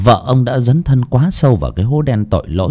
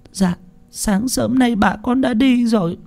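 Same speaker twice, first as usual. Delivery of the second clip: much higher, small tearful answer; tearful reply; 13 semitones higher, 4 dB quieter than usual. A narrator's reading. Servant girl answering her master, tearful. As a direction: answer meekly through tears. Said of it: "đôi mắt sưng húp".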